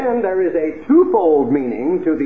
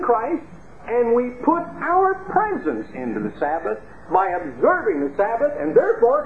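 mock self-importance; 7 semitones higher than usual. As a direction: exaggerate each claim in a lofty, raised voice. Mocking churches' self-important Sabbath claims.

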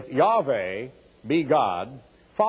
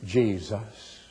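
soft, lower, reverent put-on "Jesus"; pious voice; 5 semitones lower, 4 dB quieter than usual. Preacher mocks how TV preachers say "Jesus", hushed and pious. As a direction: drip with TV piety on "Jesus". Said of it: "That's why people talk about".

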